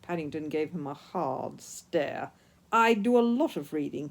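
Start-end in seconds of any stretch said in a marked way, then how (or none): 2.7-4.1 louder indignant protest; hotly. A narrator's reading. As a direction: defend himself with heat. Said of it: "he exclaimed hotly".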